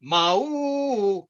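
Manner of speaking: long, drawn-out moo as a two-note chime; comic cow chime impression; cow doorbell. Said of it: "like to do a cow doorbell".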